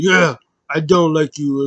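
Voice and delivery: imitating someone being mean; mock harsh retort. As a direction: act out a cold rejection, slow and loud.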